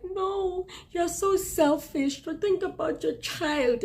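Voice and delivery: high, whiny mock scolding; mocking scolders. Mimicking people who call her selfish. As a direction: parrot the nagging in a shrill-pitched voice. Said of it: "when people are like".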